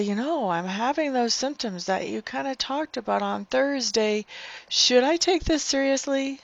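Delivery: naive questioner. Pretend. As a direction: mimic a clueless caller, pitch climbing.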